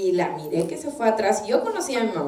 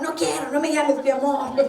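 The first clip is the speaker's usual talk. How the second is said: higher-pitched petulant refusal; acted adolescent huff; irritated teen; 4 semitones higher than usual. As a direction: play a mock irritated youth pushing affection away. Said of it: "El estado de irritación de sus corazones".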